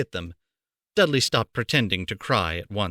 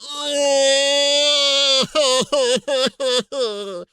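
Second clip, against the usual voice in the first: long high wail, hammed-up sob; a spoiled boy's loud fake wail; loud fake crying; 5 dB louder than usual. A narrator's reading. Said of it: "Dudley began to cry loudly".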